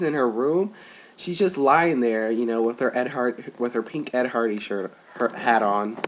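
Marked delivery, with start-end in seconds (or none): none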